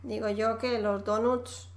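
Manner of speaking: quiet. Real, not acted. Teasing aside about donuts.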